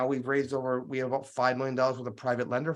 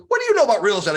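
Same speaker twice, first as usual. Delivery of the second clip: put-on gruff, exasperated old friend, higher and louder; curmudgeon friend; 9 semitones higher, 7 dB louder, much faster than usual. Grumpy old investor friend phoning, a light gruff mock. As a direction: play the grumpy friend snapping down the phone. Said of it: "curmudgeon-y at times".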